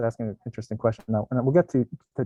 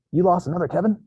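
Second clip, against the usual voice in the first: frantic mom voice; 7 semitones higher, 5 dB louder than usual. Retold or imitated. Kevin Hart commercial quote, acted.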